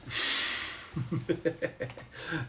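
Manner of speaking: long breathy, voiceless chuckle; soft wheezy laughter; amused laugh. Real, not acted. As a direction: laugh quietly at a joke. Laughs after canon event joke.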